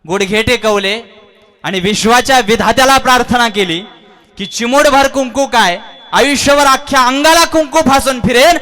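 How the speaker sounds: high, loud, impassioned cry to the lord; fervent devotee's vow. Acted. Hanuman vowing to Ram, high and loud with fervour. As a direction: pour out devotion at full voice.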